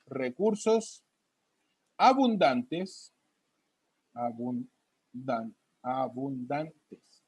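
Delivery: teacher writing notes on the board, slow dictation. Real, not acted. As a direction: drag each word out while writing.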